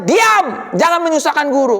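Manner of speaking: high sharp yell, then quick scolding; snapped rebuke. Acted. Crowd silences blind beggar troubling the teacher, snapping rebuke. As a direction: shut him up with a burst on the first word. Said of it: "Kenapa dia dibentak?".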